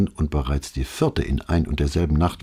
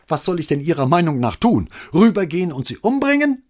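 high, loud outburst into the phone; screaming; 13 semitones higher, 5 dB louder than usual. A narrator's reading. Desperate neighbour shouting at the officer, screaming. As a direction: yell it down the receiver. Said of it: "schrie Signora Gismondi in den Hörer".

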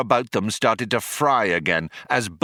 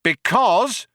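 high calling shout; 12 semitones higher, 4 dB louder than usual. A narrator's reading. Cop answers Zaphod's question, shouted. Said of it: "shouted the cop".

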